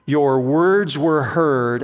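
slow, weighty stress on "words"; "words" drawn out and stressed. Shown as a demonstration. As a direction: lean hard on "words" in "your words were heard". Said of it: "And I emphasize the word words".

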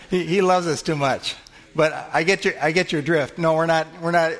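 reply through laughter to a cheeky answer; tickled, good-humored protest; amused, laughing. Real, not acted. Amused by a listener's blunt answer.

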